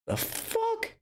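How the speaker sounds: high incredulous squeak; the fuck, way up in disbelief; shocked disbelief. Real, not acted. Reacting to a man swearing on his mother, high shocked disbelief.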